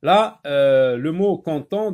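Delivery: ordinary talk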